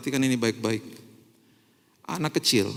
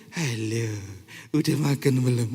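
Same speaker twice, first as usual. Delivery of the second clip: put-on baby talk; 7 semitones lower than usual. Shown as a demonstration. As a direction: coo gently as if to a toddler. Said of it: "Kita kan gini".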